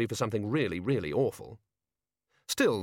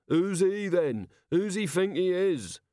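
higher, mocking jeer; jeering crowd; 8 semitones higher, slower than usual. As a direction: heckle the new duke.

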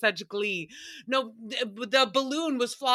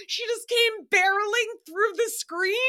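high-pitched, laughing through the story; cracking up; 10 semitones higher than usual. Real, not acted. Telling how sister crashed through the screen door, cracking up. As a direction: barely get the words out between bursts of laughter.

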